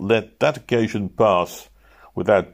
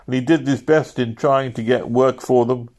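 ordinary delivery, nothing marked

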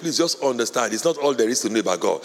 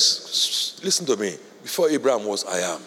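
hushed Jesus; 5 semitones lower than usual. Acted. Jesus voiced hushing the crowd.

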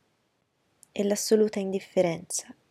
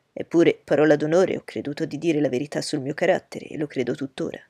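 louder, lower, teasing his friend; hearty young gentleman; 3 semitones lower, 6 dB louder than usual. Acted. Bingley teasing Darcy before his sister, loud and hearty. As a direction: tease Darcy openly and loudly.

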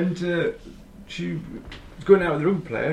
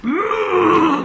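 dainty high-pitched throat clear; 6 dB louder than usual. Pretend. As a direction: clear the throat like a singer warming up. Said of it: "Clear her throat".

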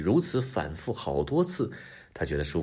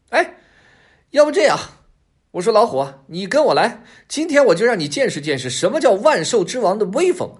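high, loud, bossy fox voice; cocky fox issuing a challenge; swaggering fox; 12 semitones higher, 8 dB louder than usual. A narrator's reading. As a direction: take charge and brag, pitched high. Fox invites tiger to follow.

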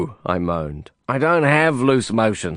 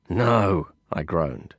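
plain reading voice

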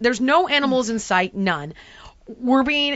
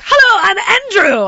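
higher and louder, a hammed-up cheery guide intro; mock tour guide greeting; 8 semitones higher, 9 dB louder than usual. Guide Andrew greeting her, hammed-up and cheery. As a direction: play the eager guide introducing himself. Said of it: "And he's like".

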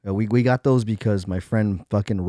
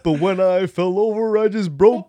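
movie character voice; 8 semitones higher, 5 dB louder than usual. Retold or imitated. Quoting movie line in voice.

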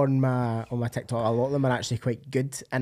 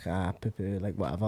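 dismissive; 6 semitones lower, 9 dB quieter than usual. Retold or imitated. Acting out his old dismissive reaction.

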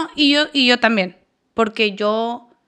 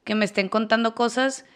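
usual speaking voice

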